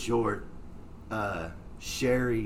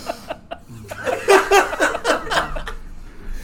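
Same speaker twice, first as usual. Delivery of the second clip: long, breathless, high-pitched laughing fit; high wheezing laugh; 9 dB louder than usual. Real, not acted. Friend cracking up at his own cop story, wheezing laughter. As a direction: let a big wheezy laugh run out of you. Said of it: "I'm dying laughing".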